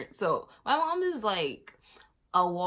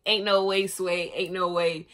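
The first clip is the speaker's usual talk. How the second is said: mad disbelief; 7 dB louder than usual. Retold or imitated.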